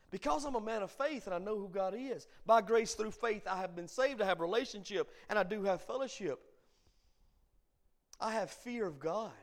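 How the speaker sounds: fervent preaching. Real, not acted. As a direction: declare it to the congregation with conviction. Preaches at full force.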